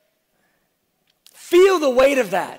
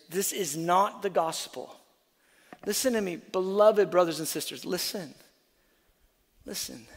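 much softer, lower pleading; hushed earnest appeal; 5 semitones lower, 9 dB quieter than usual. Real, not acted. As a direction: draw them in with a hush. Preacher drops to intimate appeal.

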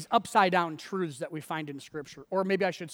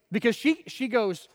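normal talking voice

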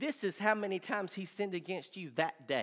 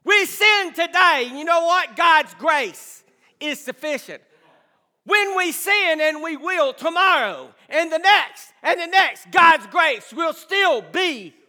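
preaching at full force; 9 semitones higher, 12 dB louder than usual. Acted. Pastor insisting grace is sufficient, building preaching shout. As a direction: hammer it home to the church.

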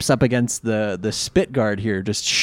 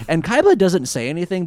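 usual speaking voice

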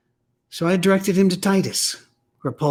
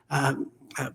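normal talking voice

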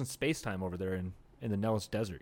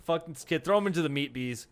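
usual speaking voice

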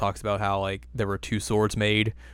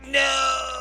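very high yelp; high frightened squeak; panicked no. Retold or imitated. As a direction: squeal in terror. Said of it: "And Jokin's like".

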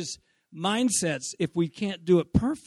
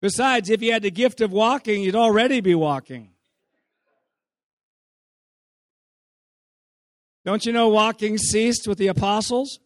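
scolding preacher; 3 semitones higher, 5 dB louder than usual. Retold or imitated. Mock preacher scolding toddler.

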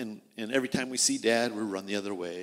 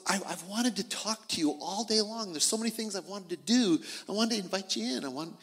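eager dad; 8 semitones higher, faster than usual. Acted. Dad longing to reconnect.

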